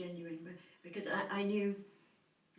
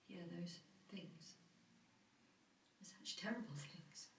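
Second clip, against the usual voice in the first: hushed horror; 16 dB quieter than usual. Acted. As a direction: barely voice it, as if too awful to say. Recalling the boy's terrible injuries.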